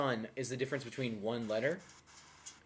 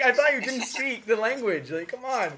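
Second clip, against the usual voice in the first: higher incredulous protest; mock disbelief; 8 semitones higher, 4 dB louder than usual. Locals protesting that the foreigner tricked them, incredulous. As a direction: play it betrayed and amused.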